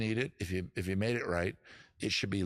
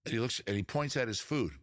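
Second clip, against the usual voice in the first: ordinary delivery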